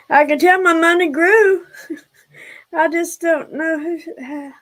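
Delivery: high and loud, laughing through tears; tearful joy. Real, not acted. Seeing donations arrive, she laughs through tears. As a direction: overcome with happy tears. Money arrives on her stream.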